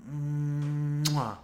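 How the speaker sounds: kiss your fingertips with delight; chef's kiss. Real, not acted. Chef's kiss over the text.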